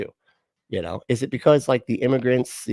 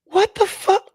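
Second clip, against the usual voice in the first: much higher, louder disbelief; can't believe what he just heard; incredulous outburst; 7 dB louder than usual. Real, not acted. Reacting to co-host's take, stunned and much higher.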